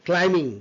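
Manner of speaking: "climbing" with a silent b; correct pronunciation demo. Shown as a demonstration. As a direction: say "climbing" as CLY-ming, ending in a nasal ng. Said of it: "not climbing, it's a climbing".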